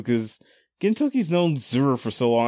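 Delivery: ordinary talk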